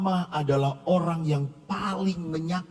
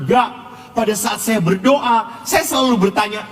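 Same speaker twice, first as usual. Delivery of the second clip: raised, insistent preaching voice, higher than his storytelling; 5 semitones higher, 9 dB louder than usual. Acted. Priest urging his congregation, preaching at full force. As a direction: drive the lesson home to the congregation.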